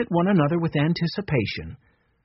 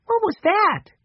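put-on high, sudden boy's question; a boy jolted by a clang; startled little boy; 3 dB louder than usual. A narrator's reading. Narrator voicing young Benny, startled.

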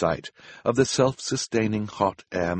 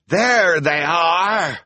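high, drawn-out shout; loud cry; 9 semitones higher, 6 dB louder, much slower than usual. A narrator's reading. Officer pointing out the children to the mob, crying out. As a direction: call out the quarry to a crowd, long and loud. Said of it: "Officer Luciana cried".

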